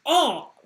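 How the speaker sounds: loud, high mock cry of pain; sudden hammed-up "oh"; acted pained yelp. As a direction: yelp as if you hurt your hand. Game master voices a punched guard.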